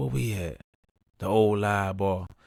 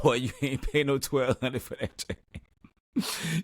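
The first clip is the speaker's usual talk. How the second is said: slow, teasing, pitched up; mocking disbelief; 6 semitones higher, 3 dB quieter, slower than usual. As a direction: call out the friend's price as a lie. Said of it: "We like".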